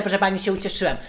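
ordinary delivery

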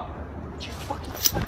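slow, hushed and threatening; slow menacing whisper. Acted. Man daring him to repeat it, slow whisper. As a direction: drag it out in a dark whisper.

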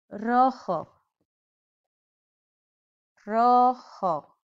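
rojo with a breathy H for the J, "roho"; Spanish J as H. Shown as a demonstration. Teacher showing the class the J in rojo, said as an H. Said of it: "The J sounds like an H".

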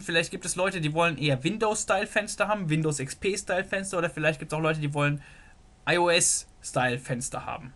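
ordinary talk, nothing marked

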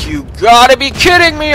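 high, loud and exasperated; exasperated shout. Real, not acted. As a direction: yell it in disbelief. Missing shot, repeated.

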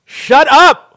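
loud, high outburst; angry shout. Real, not acted. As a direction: snap at the chatterer to stop. Fed up with the narrating passenger.

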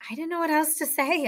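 high, amused protest; laughed. A narrator's reading. Boy to his new friends, laughing it off. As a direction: say it lightly, pitched well up, amused. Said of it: "I laughed".

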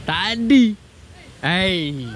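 ordinary talk

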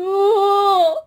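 high, loud pretend sobbing; mock sulky crying. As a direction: whine like a lonely toy. Plush sulking, crying voice. (高い声で泣きべそをかくふり)

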